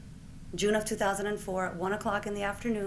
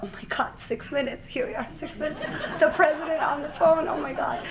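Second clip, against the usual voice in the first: high, laughing amazement to herself; talking to herself as the president is on the line; giddy disbelief; 7 semitones higher than usual. Retold or imitated. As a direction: marvel breathlessly that it's really happening. Marvelling to herself mid-call.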